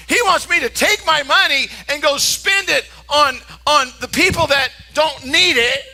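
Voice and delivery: high, eager mock realization; sarcastic enthusiasm. Preacher mocking self-serving gift ideas, with sarcastic eagerness. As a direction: pretend you've happily found the obvious answer. Mock answers to what Jesus wants.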